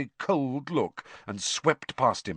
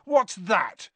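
high, angry challenge; raised-voice row retort; yelling back; 10 semitones higher, 4 dB louder than usual. A narrator's reading. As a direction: throw the question back heatedly. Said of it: "Ron yelled back".

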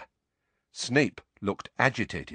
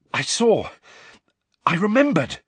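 high, excited panted exclamations; panting; 10 semitones higher, 5 dB louder than usual. A narrator's reading. Harry tells Snape what he remembered, panting. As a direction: blurt it out in short breathless bursts. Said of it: "Harry panted".

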